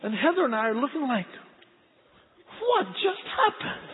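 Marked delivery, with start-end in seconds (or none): 2.6-3.9 high, slow, astonished; stunned disbelief. Retold or imitated. Parents staring as the screaming baby falls asleep, astonished. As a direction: let it rise in pure bafflement.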